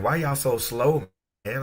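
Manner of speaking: slow, drawn-out mock complaint; lightly imitating an impatient friend, slowed down; impatient New Yorker. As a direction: stretch it out, teasing the slow pace. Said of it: "he's like".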